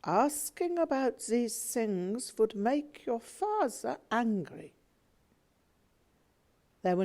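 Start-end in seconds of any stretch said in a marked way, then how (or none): 0.0-4.7 sad. Acted. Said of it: "My mother looked sad and replied".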